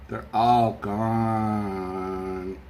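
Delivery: slow, low and quiet; sad. Real, not acted. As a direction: let it sink in slowly. Mourning lost comic books.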